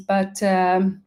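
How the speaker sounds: ordinary talk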